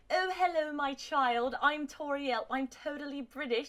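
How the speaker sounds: high, hammed-up British lady; exaggerated British parody; mock British accent. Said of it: "I just made Toriel British".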